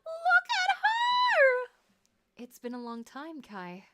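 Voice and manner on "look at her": much higher and louder, screaming with delight; ecstatic fangirl shriek. Real, not acted. Gamer sees her favorite heroine arrive, ecstatic high shriek. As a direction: shriek like you just saw your idol.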